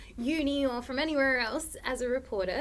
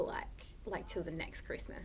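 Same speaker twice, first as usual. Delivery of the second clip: whispered aside; 6 semitones lower, 13 dB quieter than usual. Real, not acted. Confessing habit.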